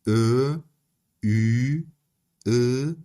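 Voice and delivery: each extra vowel held alone, clearly; Turkish vowels. Shown as a demonstration. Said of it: "ترکی سه تا حروف مصوت دیگه هم داره".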